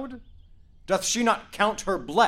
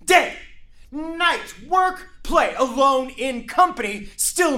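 loud, much higher shouting; raging shout; 7 semitones higher, 4 dB louder than usual. Acted. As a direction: let the fury burst out. Father explodes in fury.

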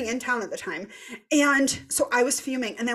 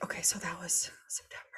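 whispered aside; 4 semitones lower, 10 dB quieter than usual. Real, not acted. Aside to herself, recalling dates.

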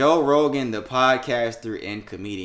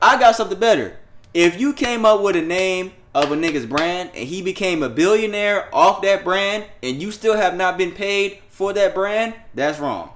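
heated rant; 8 semitones higher, 6 dB louder than usual. Real, not acted. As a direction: hammer every point home. Heated rant about unpaid brand.

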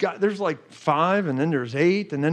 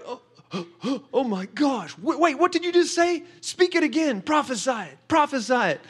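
gasp, then high, eager pleading; excited disbelief; 6 semitones higher than usual. Retold or imitated. Reliving how he begged the woman to repeat the prophecy, excited. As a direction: beg her to say it again, thrilled. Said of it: "I was sitting there like".

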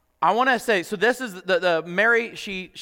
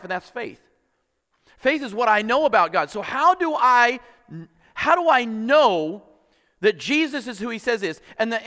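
higher and louder, climbing with each question; preaching at full force; 6 semitones higher, 4 dB louder than usual. Acted. Pastor presses his church on who Jesus is, fervent preaching. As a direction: drive the questions home to the congregation.

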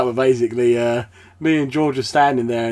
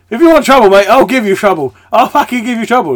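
high, loud mock aggression; acted fight threat; 10 semitones higher, 10 dB louder than usual. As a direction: shout it like squaring up for a brawl. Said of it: "But literally, they go like".

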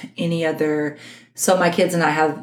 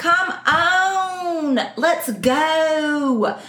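high, drawn-out Arkansas whine; Arkansas accent; 9 semitones higher, much slower than usual. Shown as a demonstration. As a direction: stretch each syllable in an Arkansas drawl. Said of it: "I can do Arkansas".

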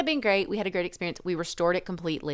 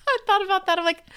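high and louder, still laughing; 13 semitones higher, 5 dB louder, faster than usual. Real, not acted. Wife laughing about the cheap boat, high and loud.

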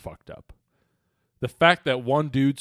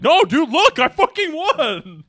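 much higher and louder, a frantic insisting yell; exasperated shout; 10 dB louder, slower than usual. Retold or imitated. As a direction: yell it as if nobody believes you. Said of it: "And it's like".

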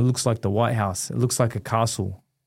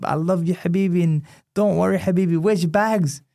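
higher, a lighter impression of [name] reassuring; imitating [name]; 6 semitones higher than usual. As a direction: raise the pitch to play a friend soothing him. Retold [name] comforting him.

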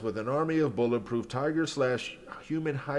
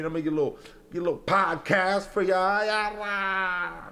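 hype voice; 6 semitones higher than usual. Pretend. Starting freestyle, mock hype.

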